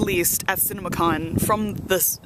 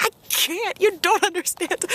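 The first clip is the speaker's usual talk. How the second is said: much higher, breathless fan wail; overwhelmed fangirl; 11 semitones higher than usual. Retold or imitated. To the friend telling her to breathe, high overwhelmed squeal. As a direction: squeal like a fan who can't cope.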